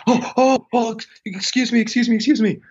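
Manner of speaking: high squeaky mock panic; flustered nun. Nun seeing the naked man, imitated flustered panic. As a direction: imitate a mortified woman apologizing over and over, very high.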